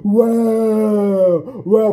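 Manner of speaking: loud, high drawn-out whoa; mock gasp. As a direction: wail it like shocked housemates. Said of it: "everybody goes".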